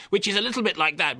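a light send-up of a put-on upper-class voice; Hoskins doing posh. Critic mimics Hoskins's posh accent, lightly. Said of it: "And now he does posh".

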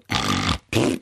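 low spluttering raspberry noise; blown raspberry. Shown as a demonstration. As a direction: deflate with a long lip-flapping splutter. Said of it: "and then you go".

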